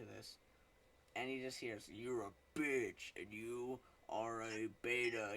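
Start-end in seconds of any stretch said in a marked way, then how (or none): 1.9-5.3 drawn-out sneering put-down; slow, contemptuous taunt; slow mocking insult. Imagined rude reply to venter.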